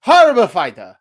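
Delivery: loud, put-on Mexican accent; Canelo's funny accent. Boxing commentator quoting Canelo for fun, in a mock accent. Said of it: "I just wanted to say a funny accent".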